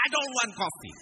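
high, loud mock refusal; a hammed-up grumpy passenger; refusing coffee. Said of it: "He said".

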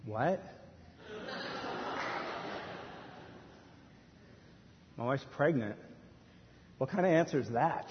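quiet, understated disbelief; disbelieving question. Acted. Husband questions God's answer, mildly incredulous. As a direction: question the news softly, rising at the end.

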